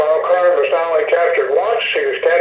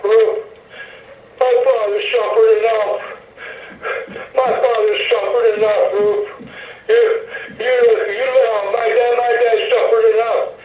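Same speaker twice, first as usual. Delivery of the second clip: a touch of tearful pleading, voice raised; crying plea; 5 semitones higher than usual. Retold or imitated. Steve pleading with Rube about his father, mild imitated crying. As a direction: retell a hurt man's tearful plea, mildly imitated. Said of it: "he's crying".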